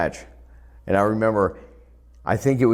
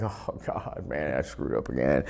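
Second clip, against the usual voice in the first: dismayed; 10 semitones lower, 6 dB quieter than usual. Retold or imitated. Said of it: "I was like".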